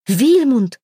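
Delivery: indignant exclamation, pitch leaping up; indignant cry. A narrator's reading. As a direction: call out the name in protest. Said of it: "возмущённо вскрикнула Ингвильда".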